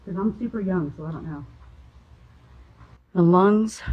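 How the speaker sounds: ordinary talk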